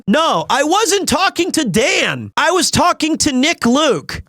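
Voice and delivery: high-pitched, insistent denial; emphatic protest. Real, not acted. Mock protest to a remark.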